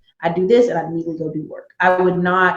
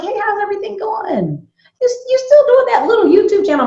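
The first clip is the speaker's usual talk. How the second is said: high, singsong mock-friendly voice; put-on high voice, belittling small talk; mimicking condescending friends; 14 semitones higher than usual. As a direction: imitate patronizing friends in a high-pitched voice.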